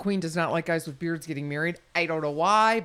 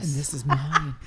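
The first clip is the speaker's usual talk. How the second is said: sexy voice; 5 semitones lower than usual. Pretend. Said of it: "This is my sexy voice".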